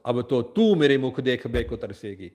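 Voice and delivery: a mild hint of a thundering god; loud thundering voice. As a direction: thunder the words down at her. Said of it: "in a loud thundering voice".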